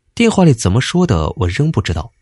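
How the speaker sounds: ordinary delivery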